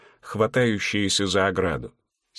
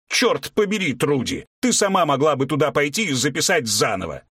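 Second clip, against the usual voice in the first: angry shout; 6 semitones higher than usual. A narrator's reading. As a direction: yell it in frustration. (раздражённый крик)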